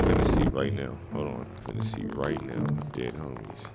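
low, hushed voice. Real, not acted. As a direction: keep it down while sneaking. Sneaking around in the game.

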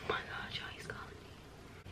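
hushed whisper, phone ringing; real hushed panic; panicked whisper. Real, not acted. Boyfriend phoning, panics.

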